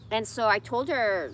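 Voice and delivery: usual speaking voice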